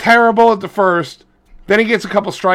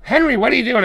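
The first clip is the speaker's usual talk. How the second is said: mother calling out; 7 semitones higher, faster than usual. Retold or imitated. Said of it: "she goes".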